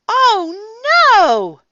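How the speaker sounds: high, loud, drawn-out dismay; crying out. A narrator's reading. Froggy realizing he forgot something, dismayed cry. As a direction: wail it high, falling on "no". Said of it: "cried Froggy".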